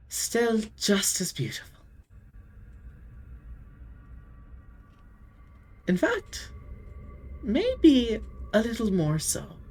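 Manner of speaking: quiet, wistful praise; soft admiring vampire. Acted. Deirdre quietly studying Saoirse's face. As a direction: murmur the compliment tenderly. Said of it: "She goes".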